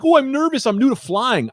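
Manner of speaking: high, timid mock voice; mocking a nervous rookie pilot in a high voice; nervous young pilot. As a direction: squeak it out like a jittery rookie pilot. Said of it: "the young guy is like".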